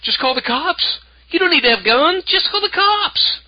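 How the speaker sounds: high, mocking official voice; squeaky-high parody of dismissive officials; mock them in a silly high pitch, stretching the last phrase; mocking police chiefs. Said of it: "the police chiefs and sheriffs would say".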